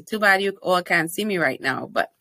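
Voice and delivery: usual speaking voice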